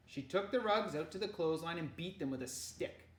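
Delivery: plain reading voice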